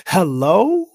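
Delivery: loud, rising hello? to the crowd; emphatic calling-out hello?. Real, not acted. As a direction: call out hello? like waking them up. Loud rhetorical call to audience.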